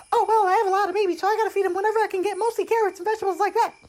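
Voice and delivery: very high, loud, racing rabbit voice; frantic mother rabbit. Acted. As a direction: rattle it off squeakily at top speed.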